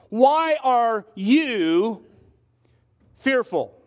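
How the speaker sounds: very slow, "you" stretched and followed by a long pause; stress on YOU. Shown as a demonstration. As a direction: lean hard on "you", then let it hang before the last word. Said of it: "I put the emphasis on a certain word".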